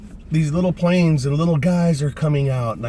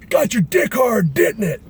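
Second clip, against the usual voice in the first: high, slow, drawn-out yell; sergeant's shouted taunt; 10 semitones higher, 4 dB louder, slower than usual. Retold or imitated. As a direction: holler it as a ribbing question.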